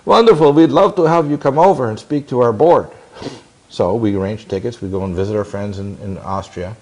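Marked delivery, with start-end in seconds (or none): 0.1-2.9 imitating a German accent, higher and louder; hearty German accent, raised up. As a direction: put on a Zurich caller's German accent. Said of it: "in a very German accent".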